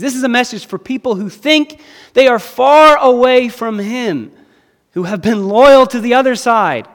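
"This is a message" 훨씬 더 크고 높게 외치듯. Real, not acted. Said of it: "repeated, rising intensity".

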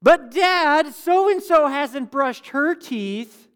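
loud, high, drawn-out tattling; whining kid voice. Retold or imitated. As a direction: drag out a child's complaint to Dad. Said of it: "it's always said in that, like, tone of voice".

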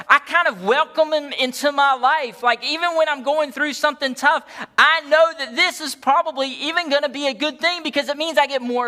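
high, loud fervent preaching; preaching at full force. Acted. Preaching peak, Paul quoted.

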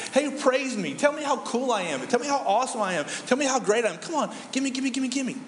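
high-pitched, hammed-up demand for praise; mock needy pestering, piling up demands; needy God. Preacher voicing God as demanding compliments, mock needy begging. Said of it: "he felt that God was saying that".

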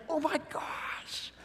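breathless discovery of the scriptures; hushed awestruck wonder; awed whisper. Retold or imitated. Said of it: "and I was like".

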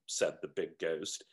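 ordinary talk, nothing marked

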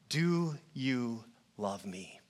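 slow hushed plea; tender hushed question. Acted. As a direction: ask it softly, as if drawing someone close. Jesus's tender question to Peter.

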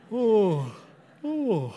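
a real yawn escaping mid-sentence. Real, not acted. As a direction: yawn for real, then excuse yourself. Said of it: "Excuse me".

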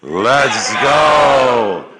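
loud "Let's go"; shouted rally call. Shown as a demonstration. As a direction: rally cry. Group chant shout.

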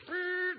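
high buzzing brrr of a finger cutting stone; playful high buzz; mock carving buzz. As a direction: buzz like a power tool. God carving the tablets.

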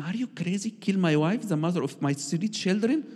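high, shocked protest; husband horrified at being told to kill his wife; outraged disbelief. Retold or imitated. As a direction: recoil at the demand.

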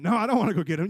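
quick protest in a squabble; hammed-up reluctant comeback; balking refusal. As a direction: refuse the job, reluctant and insistent.

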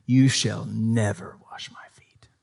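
a hushed, slow, scornful refusal; disdainful whisper. Retold or imitated. Peter refusing Jesus, in a disdainful whisper. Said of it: "You can hear the disdain".

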